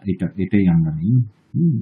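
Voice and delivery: ordinary delivery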